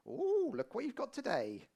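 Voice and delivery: high, laughing till-worker voice; hammed-up cashier chatting at the till; chirpy checkout lady. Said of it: "You'll be there on the tills going".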